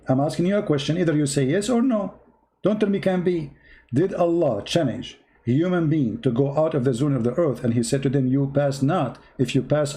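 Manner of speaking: ordinary talk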